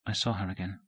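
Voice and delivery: almost under her breath; softly. A narrator's reading. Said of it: "then softly said it".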